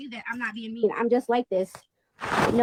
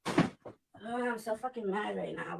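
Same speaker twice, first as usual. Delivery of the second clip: normal talking voice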